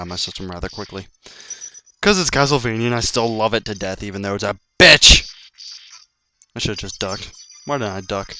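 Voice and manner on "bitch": frustrated outburst. Real, not acted. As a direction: burst into a loud shout at the end. Cursing the hard game.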